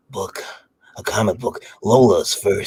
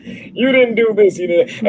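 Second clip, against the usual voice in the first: mock scolding; 12 semitones higher, 3 dB louder, slower than usual. Imitating Lola's complaint.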